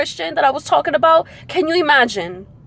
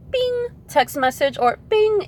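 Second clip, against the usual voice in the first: high sung bing like a notification; imitating a message alert chiming in; phone ping; 10 semitones higher, slower than usual. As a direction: chirp the alert tone.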